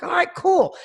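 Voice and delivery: high-pitched, eager yes; reliving eager excitement; excited agreement. Retold or imitated. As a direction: agree brightly, thrilled to be asked. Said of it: "I'm excited".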